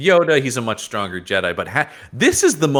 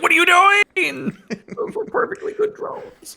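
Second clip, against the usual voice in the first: Borg voice. Retold or imitated. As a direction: squeak it out as a fussy Borg drone. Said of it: "The Borg are like".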